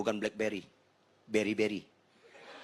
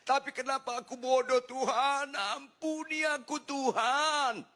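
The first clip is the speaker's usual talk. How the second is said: high childlike wail, acted out; mock wailing; 10 semitones higher than usual. Pastor repenting to God like a small child, wailing. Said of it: "saya nangis meraung-raung kayak anak kecil".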